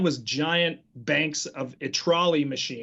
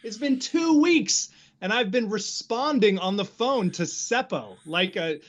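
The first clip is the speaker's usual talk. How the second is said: shocked realization; 7 semitones higher than usual. Retold or imitated. Realizing the nickname's joke.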